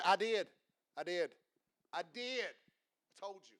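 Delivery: a hint of curt, dismissive I did; clipped mock retort, fading away. Preacher picturing answering a coworker in heaven, mock curt. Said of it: "I won't say it like that".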